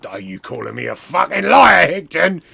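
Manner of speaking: loud, acted outrage; wrestler's booming accusation; offended wrestler. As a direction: fire it back loudly, affronted.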